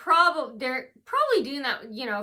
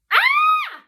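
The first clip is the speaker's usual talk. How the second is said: very high, loud sustained scream; performed scream; 12 dB louder than usual. Acted. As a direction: open the act with a piercing cry. Scream opening a performed persona intro.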